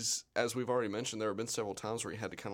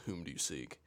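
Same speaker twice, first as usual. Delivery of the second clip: low, deliberate "Whom do you seek?" with the "Whom" weighted; stately mock-formal Jesus; mock proper grammar; 6 semitones lower, 5 dB quieter than usual. Joking about Jesus's proper grammar, low and stately. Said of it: "Shout out to Jesus for having proper grammar".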